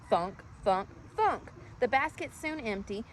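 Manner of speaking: ordinary delivery, nothing marked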